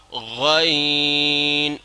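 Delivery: غین rasped harder and drawn very slow; hard ghain. Shown as a demonstration. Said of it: "عین سے ذرا سخت پڑھنا ہے".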